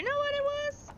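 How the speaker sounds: high timid woman's voice; frightened woman voice. Acted. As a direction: ask like a scared old woman.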